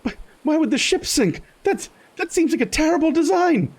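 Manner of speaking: high indignant disbelief; incredulous Lamont. Acted. Lamont questioning the ship design, high and indignant. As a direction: question it with outrage.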